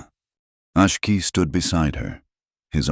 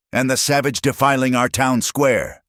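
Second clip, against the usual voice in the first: screaming preacher; 9 semitones higher than usual. A narrator's reading. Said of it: "he screamed".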